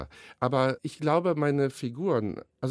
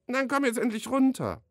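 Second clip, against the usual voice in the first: higher, bright calling out of the beaver; cheerful call; 10 semitones higher than usual. Acted. Beaver calls the butterfly down from the branch, perky higher call. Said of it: "Der Biber sagt ganz munter".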